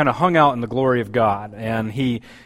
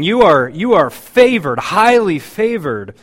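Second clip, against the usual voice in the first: higher, louder, slow emphatic praise; excited exclamation; 4 semitones higher, 6 dB louder than usual. Shown as a demonstration. Angel Gabriel exclaiming favor over Mary, excited. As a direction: lift it up like the thrilled angel. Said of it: "with, with exclamation".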